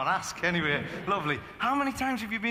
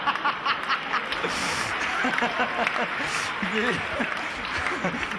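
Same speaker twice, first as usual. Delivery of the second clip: long airy unvoiced laugh; breathy laugh; 2 semitones higher than usual. Real, not acted. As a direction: laugh helplessly without voice. Laughs at own joke.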